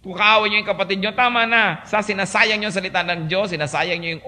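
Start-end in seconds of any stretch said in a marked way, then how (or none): none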